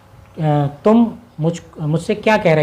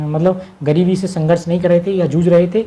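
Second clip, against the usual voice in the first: ordinary delivery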